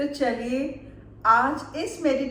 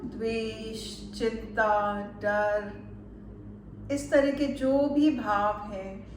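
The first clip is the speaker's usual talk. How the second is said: calm meditation guide, unhurried with long pauses; measured guided-relaxation voice, lingering on soothing words; 3 semitones lower, 4 dB quieter than usual. Acted. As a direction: ease the listener into relaxation, phrase by phrase.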